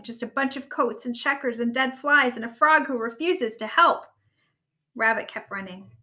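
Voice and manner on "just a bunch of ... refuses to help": loud complaint, building at the end; frustrated rant. A narrator's reading. Rabbit finding nothing, blames the frog, frustrated rant. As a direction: build to an exasperated finish. Rabbit ranting during frantic search.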